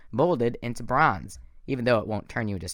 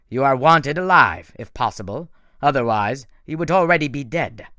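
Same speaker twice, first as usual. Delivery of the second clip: menacing villain; 2 semitones higher, 8 dB louder than usual. A narrator's reading. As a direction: command them like a cold monster. Thorne threatening.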